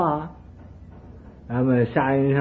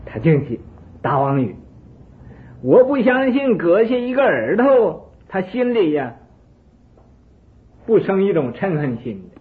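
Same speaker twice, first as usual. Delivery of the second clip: angry king; 6 semitones higher, 6 dB louder than usual. Acted. King rages at calm hermit.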